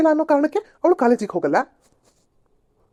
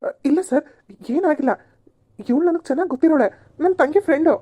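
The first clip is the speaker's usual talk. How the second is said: higher, flustered plea to the officer; nervous denial; 6 semitones higher than usual. Acted. Young man caught by police nervously explains the girl away. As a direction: talk your way out in a panic.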